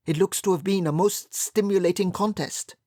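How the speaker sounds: an oblivious academic observing; Professor Singh. A narrator's reading. Professor Singh remarking on the game, fresh from the piano. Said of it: "he observed".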